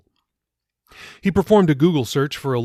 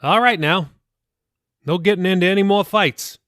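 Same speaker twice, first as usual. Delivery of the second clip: a hint of a fatherly pastor, higher; mild fatherly warning, a touch raised; lightly voiced pastor; 8 semitones higher, 5 dB louder than usual. A narrator's reading. Pastor dropping Jacob off, mild fatherly warning. Reads a book aloud.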